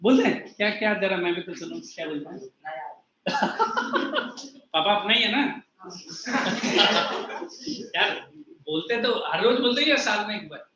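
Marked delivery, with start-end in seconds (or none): 3.2-4.5 breathy amused laugh; gentle laugh. Real, not acted. Teacher laughs at student's reply. 5.8-7.9 gentle laugh. Real, not acted. Teacher keeps laughing.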